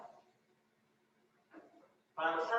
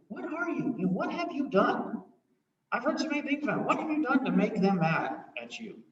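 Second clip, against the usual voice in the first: curious Pilate; 5 semitones higher, 5 dB louder than usual. Acted. Curious Pilate voiced high.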